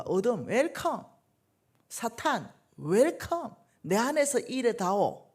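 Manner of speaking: slow, theatrical invitation; hammed-up call to Satan; mock welcome to darkness. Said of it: "이 얘기예요".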